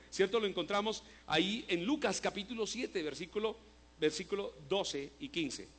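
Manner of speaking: ordinary delivery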